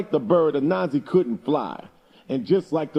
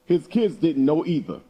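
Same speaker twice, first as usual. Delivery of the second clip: usual speaking voice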